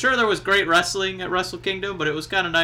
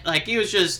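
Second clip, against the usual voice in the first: normal talking voice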